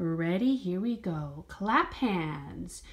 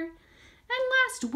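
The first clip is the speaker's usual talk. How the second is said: high. Pretend. Cueing the last movement.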